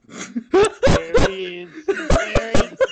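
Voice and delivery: shrieking with laughter; laughing. Real, not acted. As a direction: squeal it through helpless laughter. Laughing hard at meme picture.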